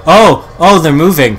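loud, high startled cry. Real, not acted. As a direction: shout it as they suddenly move. Mannequins suddenly move.

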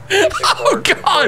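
laughing hard. Real, not acted. Laughing at chaotic scene.

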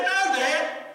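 high, loud whine; mock exasperated son; whiny son. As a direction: complain to dad.

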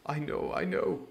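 half-whispered distressed doctor; mild cry. Acted. Doctor frets over Jim's promise, hushed. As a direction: fret aloud, in a hush. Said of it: "he cried".

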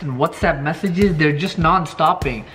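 ordinary talk, nothing marked

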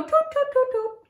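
high-pitched imitation flamingo tooting; fluting flamingo toots. Shown as a demonstration. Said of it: "Fluting like a flamingo?".

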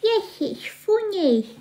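put-on slow, high babyish agreement; high-pitched baby talk. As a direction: chime in like a toddler. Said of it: "You stop talking like a baby".

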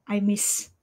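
ordinary delivery

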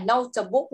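"notebook" with a vowel slipped in after the t; wrong pronunciation. Shown as a demonstration. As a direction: mispronounce "notebook" as no-tuh-book. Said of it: "Con đừng có đọc cái từ này là". (đọc sai "notebook", thêm âm "tờ" ở giữa)

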